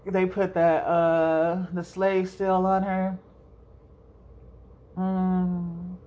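ordinary delivery

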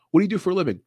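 high-pitched mock question; mock interviewer. Acts out interviewer asking Conor.

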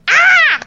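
high imitated fan squeal; fan scream. Fans screaming as stars leave their cars, high squeal. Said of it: "people were just going".

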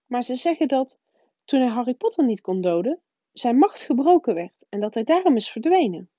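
the strict witch shaken but pressing on; trembling voice. A narrator's reading. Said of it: "Met trillende stem".

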